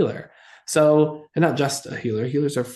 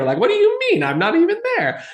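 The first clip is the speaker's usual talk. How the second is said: much higher indignant squeak; retelling own shock at being left out; outraged disbelief; 3 dB louder than usual. Retold or imitated. Teller's retold outrage.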